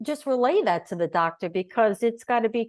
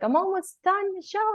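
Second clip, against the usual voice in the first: doctor reassuring; 13 semitones higher than usual. Retold or imitated. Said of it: "he's like".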